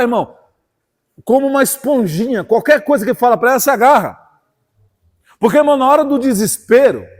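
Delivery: rising, forceful pulpit voice; impassioned preacher to the congregation; fiery preaching. Acted. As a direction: build the sermon up and drive it home.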